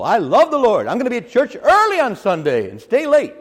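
loud, high, overeager pious boast; mock eager churchgoer. Preacher mocking a showy churchgoer, loud and high. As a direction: ham up the keen churchgoer bragging. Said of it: "says the Lord".

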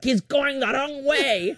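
high, slow accusing mom; mom impression. Mom watching the map accuses dad, high drawn-out complaint. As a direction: drag out the complaint as a put-on mother. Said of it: "And she's like".

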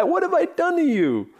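mock plea to heaven, leaping very high; hammed-up dad protesting his bad luck to God; imitating aggrieved father. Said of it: "he'd look at heaven and say".

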